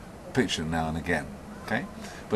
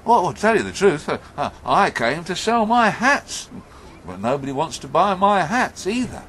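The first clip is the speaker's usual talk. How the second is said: higher, louder character voice; hat seller confessing; 8 semitones higher, 6 dB louder than usual. Acted. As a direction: own up emphatically to the same bad luck. Hat seller tells his trouble.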